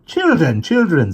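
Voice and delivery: put-on high, loud reproving "children"; a mother trying to hush her giggling kids; calling to order. A narrator's reading. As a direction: try to calm them, a little exasperated.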